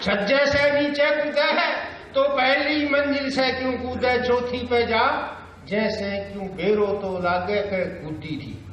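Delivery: high, slow deadpan comic poet; drawn-out comic four-liner recital; Surendra Sharma. Acted. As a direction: stretch out each line in rustic dialect. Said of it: "Surendra Sharma चार लाइनों में क्या कहता है".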